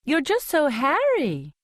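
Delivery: put-on high, lilting Capitol accent; chirpy Capitol accent, fussy; silly Capitol accent. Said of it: "she pipes in her silly capital accent".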